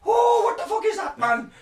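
shrieking high, loud mock Irish accent. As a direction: yell it in an over-the-top Irish accent. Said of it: "that was my Irish accent".

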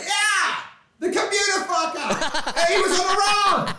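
high, laughing mock of an impressed newcomer; gushing fan. Imitating gushing new acquaintances.

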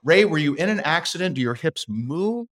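high-pitched mock instructor asking; imitating the dance teacher. Dance teacher quizzing a clumsy Ray, high mock voice. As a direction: parody an exasperated ballroom coach. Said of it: "They're like".

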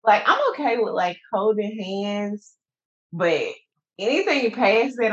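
ordinary talk

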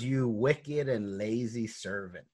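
slow, weighty retold rebuke; a master's measured condemnation, imitated; stern rebuke. Master rebukes servant in parable.

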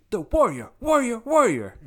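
shouted high, booming announcer hype; monster truck ad. Pretend. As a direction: yell it like a monster truck promo. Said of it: "the monster truck announcer in The Simpsons".

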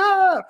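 high, loud mock yelp. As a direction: yelp like a kid chasing the ball. Kid chasing soccer ball.

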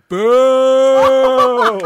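loud high laughing "Boo!". Retold or imitated. Biking past a litterer, a loud laughing boo. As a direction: boo him loudly with a laugh. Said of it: "I just go".

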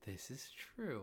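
breathy hushed agreement; whisper. Acted. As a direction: whisper it like a secret. Whispered aside.

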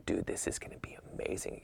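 hushed, rapid whisper; excited whisper. Retold or imitated. Golfer talking his buddy into it, hurried whisper. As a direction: whisper it fast so nobody overhears. Whispered exchange between golf buddies.

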